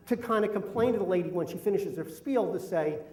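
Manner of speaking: ordinary delivery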